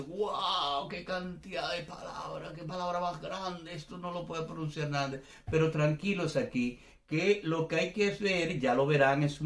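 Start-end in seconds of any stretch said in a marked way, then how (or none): 0.0-5.2 higher-pitched mock amazement; overwhelmed learner. Teacher voices a student facing huge Finnish words, mock amazed. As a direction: gasp it out like a daunted beginner. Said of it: "Tú dices".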